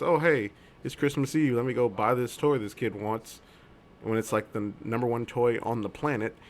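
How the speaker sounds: usual speaking voice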